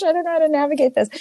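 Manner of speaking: high, fast, panicked rush; flustered panic. Retold or imitated. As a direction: blurt it high and quick, overwhelmed. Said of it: "And I was like".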